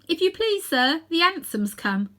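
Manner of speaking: put-on high, polite young maid; housemaid's voice. A narrator's reading. Housemaid announcing the cab to Uncle Andrew.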